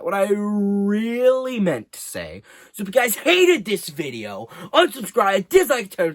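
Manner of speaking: high, loud hammed-up call; mock outburst. Youtuber yelling at haters to unsubscribe, hammed-up shout. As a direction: belt it out like an over-the-top outro.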